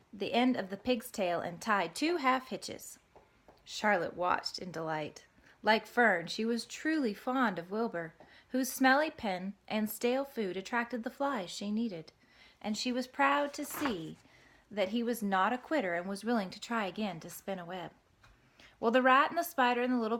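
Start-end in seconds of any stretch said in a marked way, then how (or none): none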